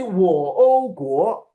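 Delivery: 我 split with a high extra o before 国; wrong split tone. Shown as a demonstration. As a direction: push a separate high o after 我. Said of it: "you can't say".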